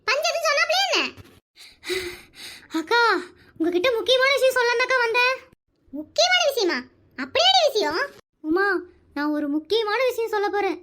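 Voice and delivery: usual speaking voice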